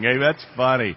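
usual speaking voice

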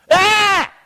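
high mock yell; horrified cry. Moses seeing his leprous hand, high yell. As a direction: yelp in acted disgust, high.

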